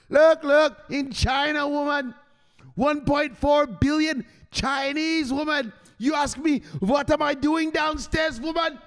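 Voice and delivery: high shouting crypto son; mock shout. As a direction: yell it at mama, slow and insistent. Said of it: "He says".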